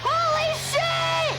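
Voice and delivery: high, drawn-out shocked cry; stunned exclamation. Real, not acted. Player shocked by what happens on screen, drawn out. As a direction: stretch it out in disbelief.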